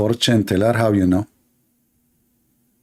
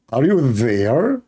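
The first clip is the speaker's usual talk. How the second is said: slow, drawn-out mock British accent; failed British accent; much slower than usual. Shown as a demonstration. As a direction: stretch it into a bad British accent. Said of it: "are you there in the British accent?".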